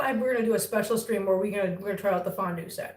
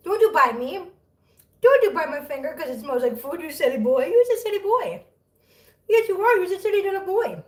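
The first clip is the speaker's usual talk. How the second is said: playful pet scolding; 7 semitones higher, 3 dB louder than usual. Real, not acted. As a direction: scold a silly kitten fondly.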